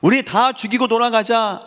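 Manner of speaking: high, drawn-out cry of a rebellious crowd; imitated cursing. People at Kadesh Barnea turn on God, imitated indignant cursing. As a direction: act out an indignant mob, pitched well up. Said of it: "하나님을 저주했다니까요".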